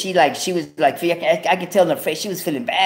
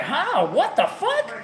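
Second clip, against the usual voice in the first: high-pitched, incredulous mimicry; shocked girlfriend; 11 semitones higher, 4 dB quieter, much slower than usual. Girlfriend hearing he must give a kidney, shocked. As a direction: jump way up in pitch, imitating her disbelief.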